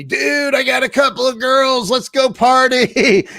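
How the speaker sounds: high, hammed-up party dude; hyped party invitation. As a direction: imitate a buddy whooping it up on the phone. Party friend's phone call, acted.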